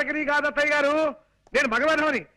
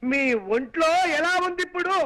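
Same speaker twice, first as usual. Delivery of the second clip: raised voice for a deaf listener; stretched-out, called-out question; loud shouting. Acted. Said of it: "అంత గట్టిగా అరవకు నాయనా".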